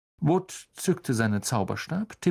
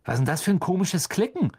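quick puzzled call; 6 semitones higher, faster than usual. A narrator's reading. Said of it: "rief Fred".

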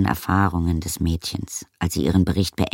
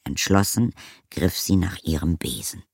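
ordinary delivery, nothing marked

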